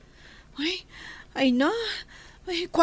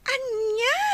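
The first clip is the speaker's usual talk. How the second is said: startled, higher "Anya?"; shocked; 5 semitones higher than usual. Acted. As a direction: blurt it out in disbelief. Shocked she is still in Philippines.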